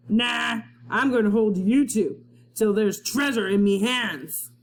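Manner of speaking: gruff pirate with a horrible grin; a captain gloating at the two kids. Acted. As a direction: gloat over the prisoners.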